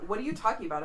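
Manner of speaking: ordinary delivery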